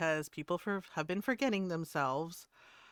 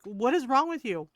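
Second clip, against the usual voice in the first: higher, louder mock exasperation; imitated spiraling out; 5 semitones higher, 5 dB louder than usual. Streamer retelling the dude spiraling out, raised exasperated voice. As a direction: mimic someone spiraling in disbelief. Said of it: "The, the dude spiraled out".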